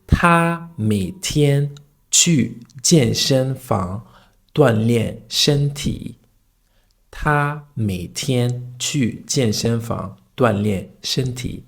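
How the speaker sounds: 他每天去健身房锻炼身体 slowly, each tone distinct; slow sentence demo. Shown as a demonstration. Teacher showing a practice sentence, slow with clear tones. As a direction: model 他每天去健身房锻炼身体 carefully in short slow phrases. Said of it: "So here is the sentence".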